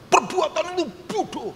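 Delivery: high-pitched mock scolding; angry insulting intonation. Shown as a demonstration. Preacher shows how Samuel did not say it, angry high scolding. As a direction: snap it out as a harsh insult. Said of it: "Ngomong bodoh itu tergantung intonasi ya".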